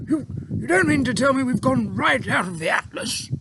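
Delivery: high, stammering terrified voice; army chief crying out in terror, stammering. A narrator's reading. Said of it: "even more terrified".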